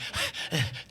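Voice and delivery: soft voiceless chuckle; exhausted gleeful breath laugh; smug quiet laugh; breathy laugh. Retold or imitated. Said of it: "I'm like".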